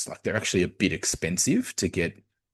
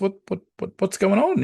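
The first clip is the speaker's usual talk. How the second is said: high-pitched baffled questioning; puzzled wondering; 11 semitones higher than usual. Acted. Coach puzzled by his sad star player, high baffled voice. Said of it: "kind of wonders".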